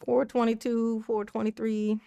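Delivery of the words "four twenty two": ordinary talk, nothing marked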